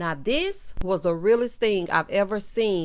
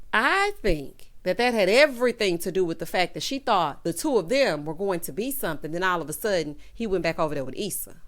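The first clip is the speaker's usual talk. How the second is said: ordinary talk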